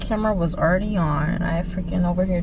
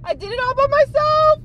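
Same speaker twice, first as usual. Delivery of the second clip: high gasping cry of pride; excited shriek. Real, not acted. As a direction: squeal it out. Triumphant after driving alone.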